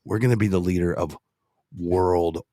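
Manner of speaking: low voice, slowing right down at the end; Dirty Harry. Shown as a demonstration. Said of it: "I sound like Dirty Harry".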